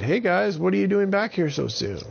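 high, bright greeting voice; a friendly character voice up high. Acted. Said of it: "She said".

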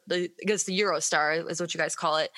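ordinary talk, nothing marked

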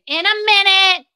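called out loud and high to Bryn; answering an assistant from afar; calling out. Real, not acted. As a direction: call it out across the room. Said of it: "Bryn asked me for something".

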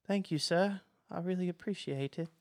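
high, timid little voice; cowed, grateful servant; nervous, scared underling. Acted. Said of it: "he's so nervous and".

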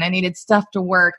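ordinary delivery

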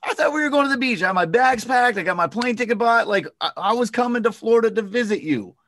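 high, loud, playful mock surprise; joking mock surprise. Joking with a prospect who says they're not selling, high and playful. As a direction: bust in with a silly high voice. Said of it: "I'm like".

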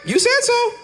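very high, squeaky mock surrender; resigned giving in. Preacher finally giving in to God, mock surrender. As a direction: give up in a high comic squeak. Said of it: "And I'm like".

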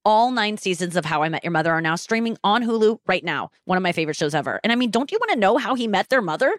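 upbeat host-read streaming ad; podcast ad spot, read casually. Acted. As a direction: sell the show to listeners. Host reads a Hulu ad.

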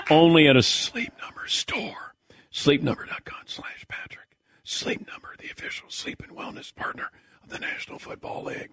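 whispered ad read; whispering. Pretend. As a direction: whisper the fine print. Whispered end of ad.

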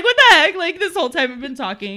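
loud, high laughing disbelief; surprised. Retold or imitated. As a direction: shriek of happy surprise. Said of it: "And she's like".

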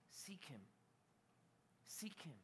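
near-whispered urging, very soft; quiet, spaced-out plea; hushed appeal. Real, not acted. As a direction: drop right down to almost a whisper. Preacher hushes for repeated appeal.

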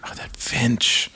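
slow awed whisper. Acted. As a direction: whisper in quiet wonder. Birdwatchers admiring a rare finch.

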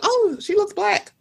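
high surprised aside; sudden recognition, light; mock realization. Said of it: "I'm like".

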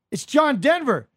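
high, loud retold answer; shouted reply. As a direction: holler it back as if over the rink glass. Shouting back to the coach, retold.

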